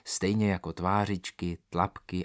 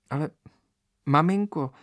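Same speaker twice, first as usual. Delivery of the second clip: sudden, loud and high outburst; blurted out; 7 semitones higher, 6 dB louder than usual. Acted. Daughter blurts protest to her mother, loud and high. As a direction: blurt it before you can stop. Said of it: "vyhrkla Elvíra".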